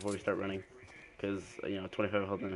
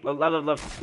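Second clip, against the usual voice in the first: combat callout; 6 semitones higher, 6 dB louder, slower than usual. Real, not acted. Repeating direction urgently.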